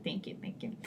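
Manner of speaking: whispered thanks; whispering. Real, not acted. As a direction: breathe it out softly.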